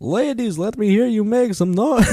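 high-pitched, loud vampire hype man; hammed-up vampire emcee; Count Hacula. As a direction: whip up the crowd like a cheesy vampire. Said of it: "dumb hack shit in a vampire voice".

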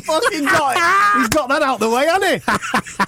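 high, slow, gloating Eddie; gleeful promoter voice. Retold or imitated. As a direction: play the smug promoter.

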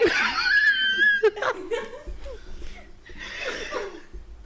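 loud, high, long laughing fit; big laugh. Real, not acted. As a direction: let the laugh take over completely. Long laugh after the quote.

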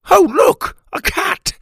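high, loud startled discovery; blurting out with surprise; sudden excited gasp. Acted. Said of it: "Hugh Little said suddenly".

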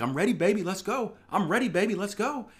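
pumped and ready to pull the trigger; hyped-up message to his trading partner; excited. Retold or imitated.

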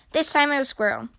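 ordinary delivery, nothing marked

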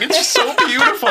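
high, wailing imitation of happy crying; hammed-up tearful gushing; mock sobbing. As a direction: imitate someone blubbering with joy. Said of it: "he was just sobbing 'cause he was so happy".

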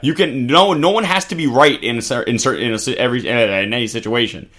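tripping over the words with false starts; stumbling over words. Real, not acted. Co-host arguing both sides are wrong, stumbling over words. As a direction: lose the phrase and keep restarting it. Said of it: "I'm stumbling over my words today".

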